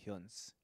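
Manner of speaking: "Huns" with a y-glide and an n before the final z; Irish "Huns". Shown as a demonstration. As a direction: say "Huns" as one syllable, yoonz. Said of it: "listen to the Irish people talking".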